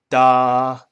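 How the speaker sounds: "da" held level and long; first tone da. Shown as a demonstration. Teacher shows students the level tone on da. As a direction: sing "da" on one flat pitch. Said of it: "straight, unchanging pitch".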